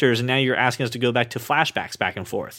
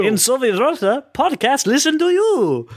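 Soviet Russian joke; 9 semitones higher than usual. Pretend. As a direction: deliver the Soviet Russia joke like a cartoon comrade. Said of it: "how awful that accent was".

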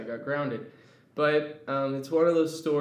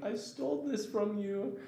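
put-on small-kid confession; 8 semitones higher, 6 dB quieter than usual. Tearful confession to manager.

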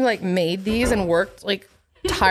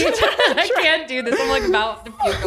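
high laughing disgust; 7 semitones higher than usual. Real, not acted. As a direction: laugh in revulsion. Grossed out by story.

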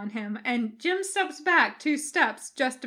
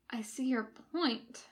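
soft whisper; 7 dB quieter than usual. A narrator's reading. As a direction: whisper it flatly. Bones deflating Jim.

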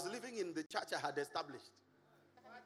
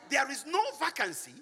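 loud landlord; 8 dB louder than usual. Retold or imitated. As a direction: boom it out like the landlord himself. Landlord offering the house.

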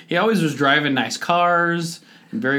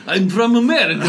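loud, higher, hammed-up Russian accent; terrible Russian accent; 9 semitones higher, 7 dB louder than usual. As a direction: boast it in a bad Russian accent. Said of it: "doing a terrible impression of them".

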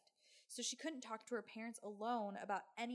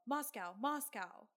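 mock yell, drawn out; half-strength retold shout; imitated yell; 5 dB louder, slower than usual. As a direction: imitate a man yelling it slowly, a bit louder. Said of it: "all he did was yell".

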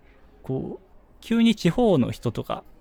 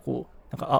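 ordinary talk, nothing marked

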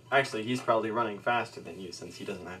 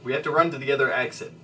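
quick, louder commanding order; commanding Drift; 4 semitones higher, 6 dB louder, faster than usual. Acted. Drift orders the party to flee through the tunnel, quick and commanding. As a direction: rap out the order fast. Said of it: "commands Drift".

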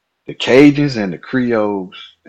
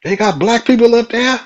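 high, loud mock disbelief; imitated astonishment; 5 dB louder than usual. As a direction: imitate an astonished Cajun local. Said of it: "He's like".

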